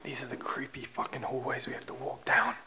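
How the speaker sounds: creeped-out whisper; very soft, voiceless narration; hushed whisper. Real, not acted. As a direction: whisper to the camera in a creepy hallway. Filming creepy hallways, hushed.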